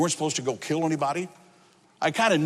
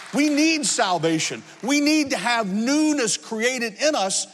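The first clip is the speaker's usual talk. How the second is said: slow, raised-pitch sermon delivery; emphatic preaching; 4 semitones higher than usual. Real, not acted. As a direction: drive the moral home slowly.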